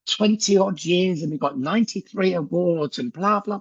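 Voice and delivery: mock the bright canned sales patter; overly cheery doorstep pitch; cheesy salesman pitch. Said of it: "I think we go".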